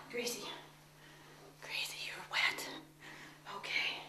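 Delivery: breathy whisper to the cat. Real, not acted. Owner whispers to her soaked cat, hushed. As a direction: hush it right down to a whisper.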